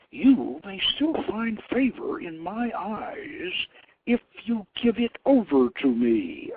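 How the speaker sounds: a goddess demanding the bow as her due; imperious goddess. Acted. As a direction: tempt him with favor, slowly.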